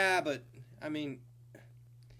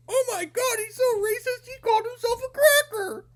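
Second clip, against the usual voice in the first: offended listener; 8 dB louder than usual. Retold or imitated. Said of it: "some person that listens to this podcast goes".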